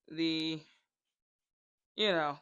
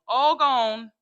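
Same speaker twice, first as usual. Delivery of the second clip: emphatic; 4 semitones higher, 9 dB louder than usual. Retold or imitated. Farmer repeats in mock story.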